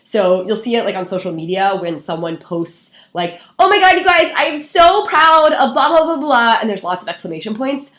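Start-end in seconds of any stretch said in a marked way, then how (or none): 3.6-6.6 high, loud, gushing mock excitement; overly chipper. Seller posting online with fake pride, overly chipper. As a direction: gush like an over-excited social media post, drawing out the words. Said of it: "suddenly get really, really excited".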